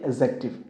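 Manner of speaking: एडजेक्टिव said with the d silent, like "ejective"; एडजेक्टिव pronounced without the d; silent d pronunciation. Shown as a demonstration. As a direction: say एडजेक्टिव as e-jek-tiv, no d sound. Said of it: "इसकी correct, correct pronunciation क्या होती है".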